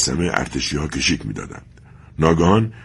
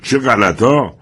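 mocking scoff; 7 semitones higher, 5 dB louder, much slower than usual.

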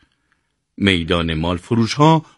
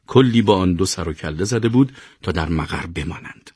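usual speaking voice

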